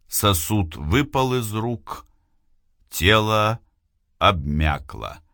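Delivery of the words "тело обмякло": plain reading voice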